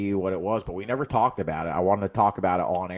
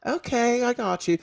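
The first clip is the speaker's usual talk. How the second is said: sweet worker voice; 14 semitones higher than usual. Retold or imitated. Kind worker agreeing to make fries.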